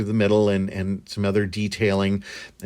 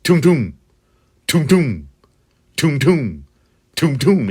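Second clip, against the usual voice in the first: punchy booming toom sounds, each falling; rhythmic vocal throb of the light; imitated pulsing hum; 4 semitones higher, 4 dB louder than usual. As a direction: thump out each pulse like a heartbeat. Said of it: "it would always be like".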